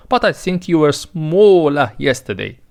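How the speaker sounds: ordinary delivery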